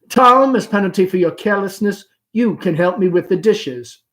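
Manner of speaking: a mock scold to her son; mother playfully ordering her son; Amanda scolding.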